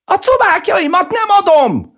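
very high, loud screech; woman's shriek. A narrator's reading. Village woman refusing to give up her legs, shrieking. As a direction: squeal it out in sharp refusal. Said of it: "visította egy asszony".

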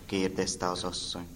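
ordinary delivery

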